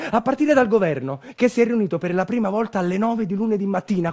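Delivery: normal talking voice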